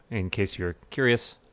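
usual speaking voice

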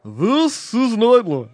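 slow, loud, grave anchorman voice; Ted Koppel impression. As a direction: intone it like a solemn late-night anchor. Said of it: "Ted Koppel".

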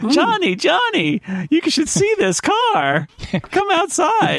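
high, drawn-out call to a neighbour; slow, high-pitched mock calling. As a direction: holler for Johnny to come outside, stretching the words. Calling to neighbour Johnny outside.

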